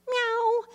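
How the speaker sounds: put-on toddler's meow. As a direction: squeak the child's cat sound. Child's cat sound at bedtime.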